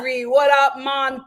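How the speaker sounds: loud mock Jamaican accent; mock Jamaican greeting, shouted; faux Jamaican accent. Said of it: "the terrible faux Jamaican accent".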